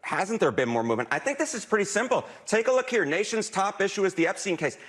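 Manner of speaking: ordinary delivery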